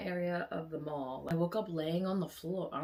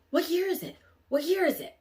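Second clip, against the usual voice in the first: higher and louder, urgent repeated question; frantic demand; 12 semitones higher, 9 dB louder than usual. Retold or imitated. Lost girl demanding the year from strangers, loud and urgent. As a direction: press the guys for an answer, alarmed.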